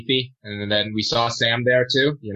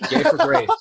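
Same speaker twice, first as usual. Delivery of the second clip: hammed-up high punchline; 4 dB louder than usual. Shown as a demonstration. Punning on the professor story, hammed-up high. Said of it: "Is that a pun?".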